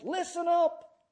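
high, loud, drawn-out bellow; teacher's bellow. Retold or imitated. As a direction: bellow it like a teacher silencing a class. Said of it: "she would bellow at the top of her voice".

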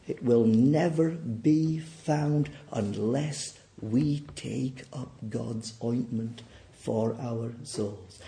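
ordinary talk, nothing marked

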